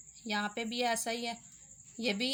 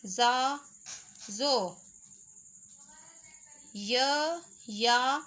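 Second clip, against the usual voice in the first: syllable drill; much slower than usual. Shown as a demonstration. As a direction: sound out the letter family for beginners. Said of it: "र का ख़ानदान है". (ज़ोर देकर एक-एक अक्षर बोलना)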